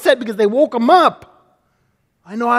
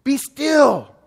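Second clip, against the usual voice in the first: a touch of Jesus rebuking the storm; calm, firm command, the first word pitched high; lightly voiced rebuke, Peace swooping up; 6 semitones higher, much slower than usual. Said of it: "he rebuked the wind and said".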